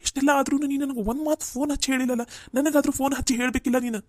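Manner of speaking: upset scolding. Acted. Brother scolds his sister for not phoning him, loud and reproachful. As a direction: tell her off for keeping it from you.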